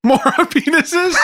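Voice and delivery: high, slow shout breaking into laughter; real laughing yell. Real, not acted. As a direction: cackle out the word.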